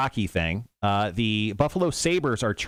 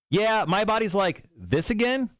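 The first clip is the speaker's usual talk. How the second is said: higher voice acting out the body's complaint; quote read as a bit; 9 semitones higher than usual. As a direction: pitch it up as if the body groans. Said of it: "All right, one more time".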